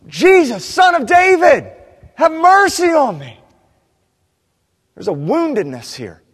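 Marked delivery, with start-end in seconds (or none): 0.1-3.4 much higher, much louder anguished shout; slow, raw, pleading yell; desperate cry. Retold or imitated. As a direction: cry out like a wounded animal begging for mercy. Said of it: "This is a desperate cry".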